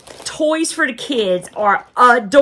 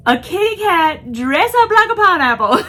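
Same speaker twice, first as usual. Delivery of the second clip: laughing through it, higher and louder; 9 semitones higher, 6 dB louder than usual. Real, not acted. Repeating the pineapple cat line to viewers, laughing hard. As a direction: barely get it out through laughter.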